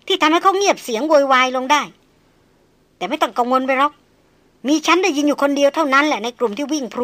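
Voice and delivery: put-on young noblewoman teasing the hunter about the girl; proud mocking noblewoman. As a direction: needle him with proud mockery. Lady taunts the hunter.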